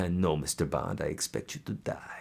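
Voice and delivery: hushed, sinister villain, sinking into a whisper; chuckle, then play a soft-spoken movie villain; Bond villain. Acted. Quotes a Bond villain as a joke.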